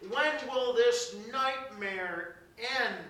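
loud, slow, anguished question; rising preacher's lament; anguished cry. Acted. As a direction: cry out to heaven. Preaching climax.

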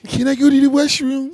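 high, timid squeaky request; mouse voice. Retold or imitated. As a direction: ask in a tiny mouse voice. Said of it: "talk like a mouse around the house".

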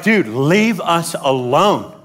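low, drawn-out weary protest; fed-up complaint. Acted. Israelites telling Moses off, fed-up drawl. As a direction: drag it out, thoroughly fed up.

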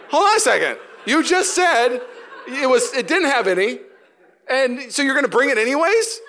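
loud, high, hammed-up outrage; mock indignant protest. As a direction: call out the waiter in comic exasperation. Acts out protest at the waiter.

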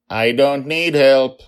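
high, loud defiant shout. Acted. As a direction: snap back stubbornly. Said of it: "she shouted".

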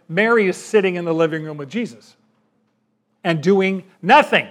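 normal talking voice

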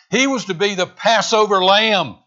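usual speaking voice

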